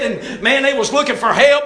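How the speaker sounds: ordinary talk, nothing marked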